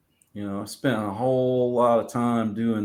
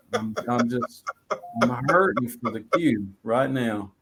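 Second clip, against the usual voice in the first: ordinary delivery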